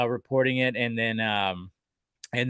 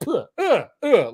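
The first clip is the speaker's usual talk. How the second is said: high, strained grunts as if being hit; mock pained grunts; 9 semitones higher, 4 dB louder, slower than usual. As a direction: grunt like you're taking punches. Superman getting smacked by ball.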